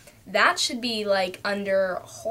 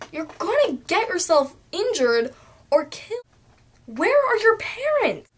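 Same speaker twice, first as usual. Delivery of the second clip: alarmed scolding; 6 semitones higher than usual. Real, not acted. Vlogger scolds Dora about dangerous adventures, high alarmed. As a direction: scold her like a panicked parent.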